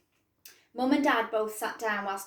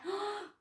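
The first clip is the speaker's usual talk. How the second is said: gasp; 10 semitones higher, 6 dB quieter than usual. Acted. Reaction to the bear.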